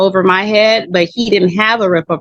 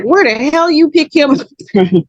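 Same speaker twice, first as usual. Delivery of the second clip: higher, incredulous mock brother; imitating brother; 8 semitones higher than usual. Brother demanding where she found the boyfriend, mock incredulous. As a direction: fling the question up in disbelief. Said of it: "he said".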